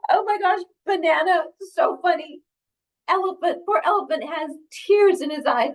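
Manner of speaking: high, animated exclaiming; excited story reading. Acted. Reader plays Elephant overcome by banana joke, excited. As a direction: exclaim with amused, high-pitched energy.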